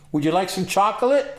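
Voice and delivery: high, loud, mock-innocent voice; innocent offer. Caught with hash, offers the policeman chocolate innocently. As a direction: play dumb, sweetly offering chocolate. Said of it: "I look up to him and I go".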